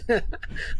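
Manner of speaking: short breathy chuckle; real chuckle at being flattered; amused laugh. Real, not acted. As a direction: laugh it off briefly. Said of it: "I'm just laughing".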